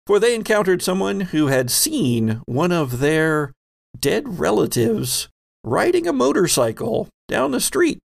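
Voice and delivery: ordinary talk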